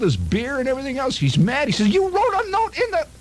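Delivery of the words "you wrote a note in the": mimics his dad's outraged yell, much higher; hammed-up angry old man, pitch way up; furious father. Father yells, spills his beer.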